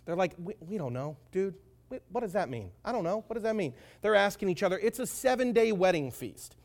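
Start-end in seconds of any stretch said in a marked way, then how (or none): 0.4-3.7 softly voiced confusion; perplexed. Wedding guests asking each other, perplexed. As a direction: murmur it, mildly baffled. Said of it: "the whole wedding party was perplexed".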